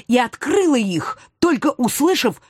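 plain reading voice

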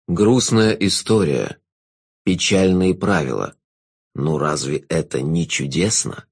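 low, slow, velvety jester; sinister jester. Acted.

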